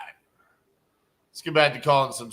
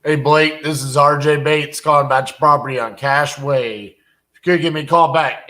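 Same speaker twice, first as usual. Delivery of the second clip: loud, upbeat voicemail greeting; 10 dB louder than usual. Real, not acted. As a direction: project it into the phone. Leaving a voicemail.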